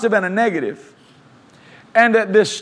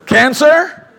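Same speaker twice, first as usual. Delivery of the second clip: rebuking cancer; 3 semitones higher, 4 dB louder than usual. Acted. Preacher rebuking sickness for the congregation, loud command. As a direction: speak to the cancer with authority.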